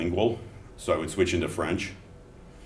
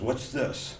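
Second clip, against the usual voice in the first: suspicious customs officer; 8 semitones higher, 5 dB quieter than usual. Retold or imitated. French customs guy finds bag.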